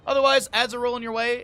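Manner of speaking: bright, loud, high call to the viewers; loud, cheery announcer hype; playful announcer call. Real, not acted. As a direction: announce it like a big reveal. Announcing ads to stream viewers.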